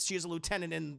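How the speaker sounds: usual speaking voice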